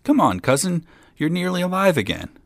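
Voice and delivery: high, eager coaxing; encouraging. A narrator's reading. Boy coaxing his dead cousin back to life, encouraging. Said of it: "to encourage him".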